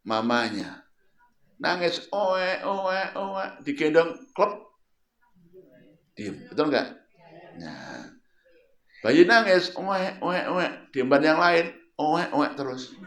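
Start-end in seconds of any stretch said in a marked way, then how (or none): 1.6-4.5 mock baby crying. 9.0-12.9 mock baby crying. Imitates crying baby again.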